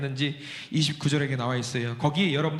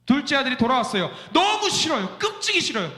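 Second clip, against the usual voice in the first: mock calf complaint; 7 semitones higher than usual. Fattened calf voiced hating return.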